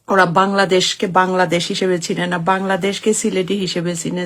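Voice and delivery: usual speaking voice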